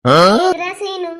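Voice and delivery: drawn-out loud call across the corridor; slow, loud shout to get Seenu's attention. Acted. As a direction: call his name out slowly and loudly. Calling out to Seenu in hospital.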